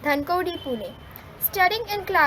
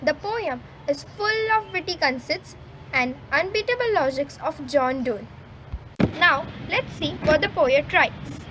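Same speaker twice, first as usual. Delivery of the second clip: ordinary talk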